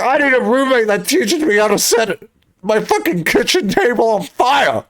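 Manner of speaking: high, exaggerated frantic rant; mock tirade. Ranting to a baffled friend about wanted roommates, mock tirade. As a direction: plead it in hammed-up outrage.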